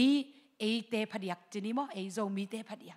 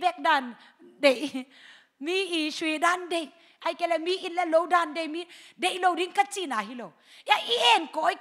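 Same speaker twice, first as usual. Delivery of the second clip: loud, raised preaching voice; fiery preaching; 7 semitones higher, 6 dB louder than usual. Acted. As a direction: drive it home to the congregation. Preacher exhorts at full force.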